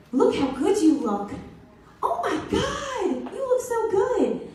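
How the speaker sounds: high, drawn-out gushing compliments; gushing praise. Retold or imitated. Admirers praising her weight loss, gushing. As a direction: gush up high, stretching the words, imitating admirers. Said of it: "The praise that I got".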